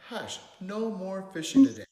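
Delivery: sharp storybook telling-off; Gramps yelling. A narrator's reading. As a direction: yell it as a fed-up grandpa. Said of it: "And Gramps yelled".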